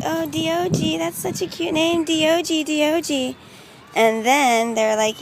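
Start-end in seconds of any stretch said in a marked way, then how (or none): 0.0-3.4 mock little kid. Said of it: "And I would be like".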